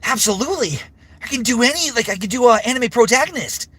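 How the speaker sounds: very high energetic anime hero; anime protagonist voice. Shown as a demonstration. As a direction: pitch way up like an anime lead. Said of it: "Can I do an anime voice?".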